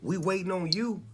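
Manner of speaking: a hint of eager welcoming callers; lightly voiced gospel kings. Gospel legends inviting the rapper to join, mildly voiced. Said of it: "kings and queens of gospel saying".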